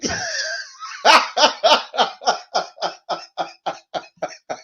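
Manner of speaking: loud, high shrieking laugh; howling laughter. Real, not acted. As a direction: crack up till it hurts. Laughing hard at the prank.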